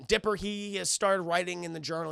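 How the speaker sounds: normal talking voice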